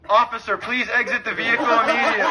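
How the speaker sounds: very loud, official bullhorn command; hammed-up cop megaphone voice; mock megaphone order. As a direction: blast it like a police loudspeaker. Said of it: "I hit 'em with the quick".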